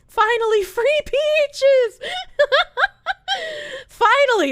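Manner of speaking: high giggling through the words; real laughter at her own joke; laughing. Real, not acted. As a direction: laugh while blurting it out. Laughing at free peaches.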